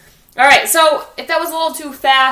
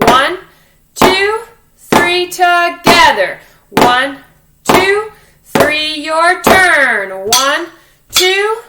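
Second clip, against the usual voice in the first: called-out count, higher and louder; 4 semitones higher, 4 dB louder, much slower than usual. Shown as a demonstration. Teacher calling the beat for the class. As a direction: call the count over the drums. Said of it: "counting the class in".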